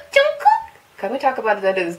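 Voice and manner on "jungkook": very high, loud squealing gasp of the name; excited fan squeal. Real, not acted. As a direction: squeal like you just spotted your idol. Fan sees favourite member.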